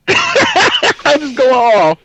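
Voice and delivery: high, loud words through laughter; laughing. Real, not acted. Player laughs at retelling his outburst, laughing. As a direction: laugh while telling it, pitch way up.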